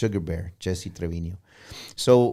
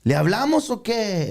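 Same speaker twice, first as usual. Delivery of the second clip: ¿Le hablamos o qué? pitched higher and called out; hyped question to the crowd; 10 semitones higher, 3 dB louder than usual. Retold or imitated. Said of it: "take out my phone like this".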